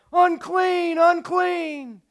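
high, loud cry of warning. A narrator's reading. A leper warning the camp, crying out high and loud. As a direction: call out the warning to everyone near. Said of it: "and cry out".

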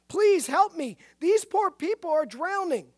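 loud, high plea for help; crying out. A narrator's reading. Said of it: "he cried out".

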